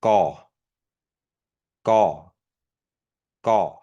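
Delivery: Gaw with a tight k-like g; bracketed G. Shown as a demonstration. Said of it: "The bracketed G".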